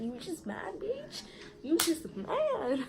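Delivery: high, drawn-out mock question; mocking taunt. Girl taunting her rival in a retold quarrel, mocking.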